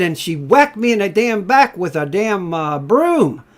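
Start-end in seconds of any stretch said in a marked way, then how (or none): none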